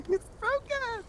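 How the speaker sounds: high mock kid voice; meme quote. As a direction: echo the meme squeaky. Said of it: "echoing the meme".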